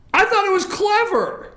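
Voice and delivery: high sing-song nudge; playful pleading. Real, not acted. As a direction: coax her into agreeing. Host pleading for agreement.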